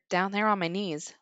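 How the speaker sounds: plain reading voice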